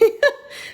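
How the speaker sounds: airy giggle burst; breathy high laugh. Real, not acted. Laughs at own dog voice.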